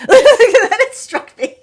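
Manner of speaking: slow, laughing, high and loud repeat. Shown as a demonstration. Savoring her own joke, laughing through it. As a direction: laugh it out slowly. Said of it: "laughs, repeats slowly".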